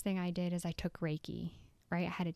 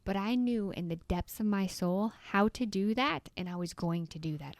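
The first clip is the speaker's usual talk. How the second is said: normal talking voice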